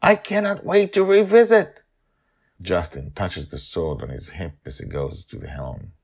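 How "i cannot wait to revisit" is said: high eager chirp; barely able to wait; excited. A narrator's reading. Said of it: "Madeline is excited".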